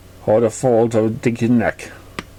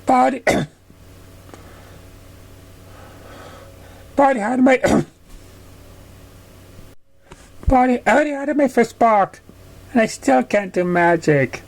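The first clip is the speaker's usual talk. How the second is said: high, whiny little filly voice; whining filly; 9 semitones higher than usual. Acted. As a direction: whine like a sad little pony. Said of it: "They could whine sadly".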